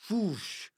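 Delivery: high, falling whoosh noise; whoosh, swooping down in pitch; imitated whoosh. Shown as a demonstration. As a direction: make a quick whooshing sound. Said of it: "like that".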